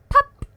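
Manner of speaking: high, loud popping sound; loud pop. Pretend. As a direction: pop it like a bubble bursting. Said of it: "It go".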